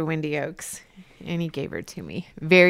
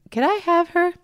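higher and louder hopeful plea; pleading ask; 13 semitones higher, 7 dB louder than usual. Retold or imitated. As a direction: rise up high, begging sweetly. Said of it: "and said, "God".

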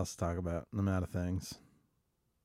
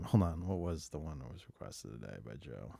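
ordinary delivery

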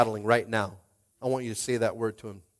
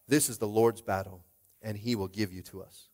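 usual speaking voice

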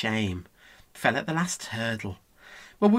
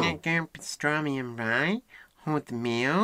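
tentative copy of a New York accent; weaker New York accent. Shown as a demonstration. Pupil copies the coach less well, half-hearted New York accent. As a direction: imitate New York, rising like a question. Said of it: "not as good".

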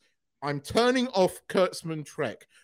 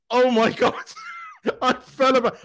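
laughing; 9 semitones higher, 6 dB louder than usual. Real, not acted. Delighted at Worf's entrance.

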